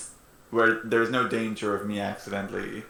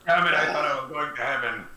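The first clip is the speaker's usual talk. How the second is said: gruff voice; 2 semitones higher than usual. Acted. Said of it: "fix another one gruff voice".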